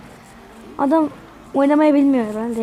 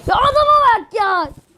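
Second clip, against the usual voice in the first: high, strained disbelief; can't believe what he's seeing; stunned disbelief; 8 semitones higher than usual. Real, not acted. Gamer stunned by opponent's move, high disbelief.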